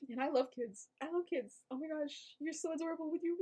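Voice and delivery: high, soft, put-on adoration; mock-sweet cooing. Viewer backtracking after ranting about the boy, mock-sweet cooing. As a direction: coo softly as if to a cute kid.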